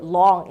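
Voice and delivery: long drawn out; lengthened word. Shown as a demonstration. Student demonstrating a longer syllable, long drawn out. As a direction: stretch the vowel in long. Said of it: "A syllable could sound longer".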